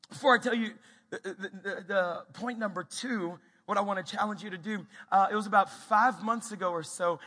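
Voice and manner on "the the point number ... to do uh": ordinary talk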